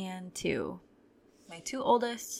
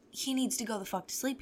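usual speaking voice

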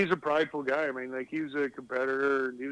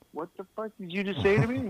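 angry retort; 6 semitones higher than usual. Retold or imitated. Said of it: "he goes".

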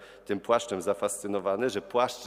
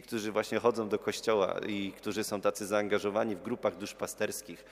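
usual speaking voice

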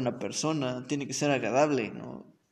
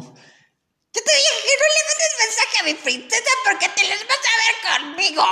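very high, loud mock threat; threatening boyfriend; 7 dB louder than usual. Boyfriend's text threat read out, high and shouting. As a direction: threaten like a jealous kid. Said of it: "lo voy a decir como".

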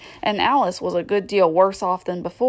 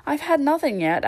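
high-pitched, mildly affronted reply; offended; 7 semitones higher than usual. A narrator's reading. Alice answering the March Hare, mildly offended. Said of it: "replied in an offended tone".

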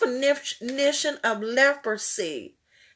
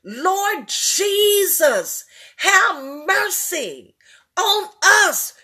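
slow, drawn-out prayer for mercy; slow pleading cry; 5 semitones higher, slower than usual. Real, not acted. Preacher leading the church in a slow plea. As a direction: stretch each word out like a plea. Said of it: "we are still crying out".

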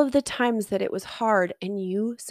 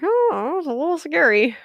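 higher, louder, half-laughing echo of her words; mock scared squeak; 8 semitones higher, 4 dB louder than usual. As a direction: echo her line in a high, wry squeak. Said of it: "I'm like".

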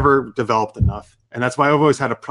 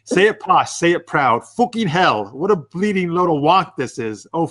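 put-on hammy British accent, raised pitch; bad posh British impression; 4 semitones higher, slower than usual. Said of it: "Sorry for my British accent".

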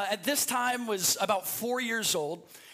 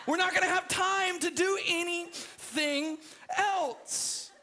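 higher, whiny pleading that builds into a mock sob; imitating a crying four-year-old; 7 semitones higher than usual. Pastor mimics his crying brother complaining to mom, higher tearful whine. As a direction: play the tearful little brother protesting. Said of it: "And he begins to cry".

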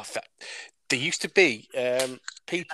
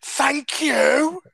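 high, loud sarcastic yell; retold shout, pitched way up; passive-aggressive shout; 13 semitones higher, 7 dB louder than usual. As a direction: holler it with pointed fake politeness. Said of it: "I shouted at her really passively aggressively".

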